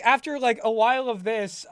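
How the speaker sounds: normal talking voice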